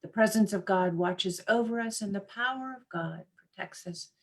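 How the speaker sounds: usual speaking voice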